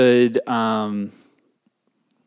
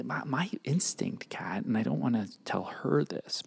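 much softer, near-whispered aside; hushed confiding; 10 dB quieter than usual. Pretend. Host confides in co-host what listener shouldn't hear, hushed. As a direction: drop to a secretive hush. Confiding advice meant to be secret.

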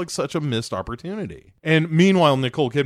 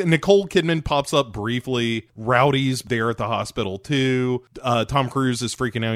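ordinary delivery

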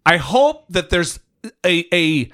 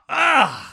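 disgusted groan; 7 semitones higher than usual. Real, not acted. Disgust at Trump sycophants.

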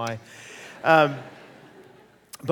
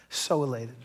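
"so elated" repeated softer and lower; soft echo; 3 semitones lower, 6 dB quieter than usual. Real, not acted. Recalling his daughter's birth, quiet echo. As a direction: let "so elated" fade to a hush. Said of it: "I was so elated".

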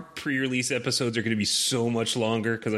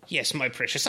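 cartoon villain voice; 5 semitones higher than usual. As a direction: gloat like a cartoon baddie. Villain voice after cartoon villain remark.

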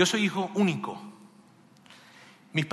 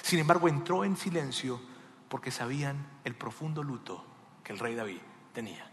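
ordinary talk

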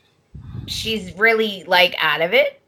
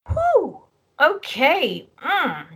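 высокий ошарашенный выдох «Whew», потом тише; играет ошарашенного отца, выдыхая новости; stunned whew; 9 semitones higher, much slower than usual. Retold or imitated. Imitating Ridge taking news.

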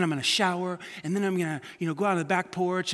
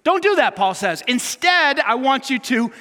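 louder, higher pulpit urgency; preaching at full force; 3 semitones higher, 6 dB louder than usual. Real, not acted. Pastor urging church not to yield to evil, fervent and loud. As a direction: exhort them passionately.

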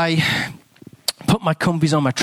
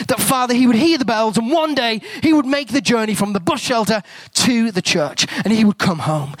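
fervent prayer; 4 semitones higher than usual. Acted. Continues praying over Derek.

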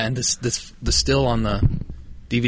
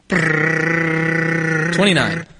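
drawn-out, higher announcement of the number; dramatic reveal; 6 semitones higher, 3 dB louder, much slower than usual. Pretend. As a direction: stretch it out like a big reveal. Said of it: "drum roll, por favor?".

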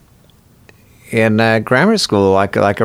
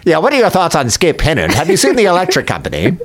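higher, precise, self-mocking version of his own voice; bad Doctor Venture impression; 6 semitones higher than usual. Shown as a demonstration. Guest imitating himself as a bad Doctor Venture, higher. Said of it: "This is me doing an impression of me".

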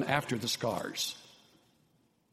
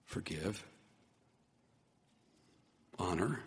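fading list; 5 semitones lower, 8 dB quieter than usual. Real, not acted.

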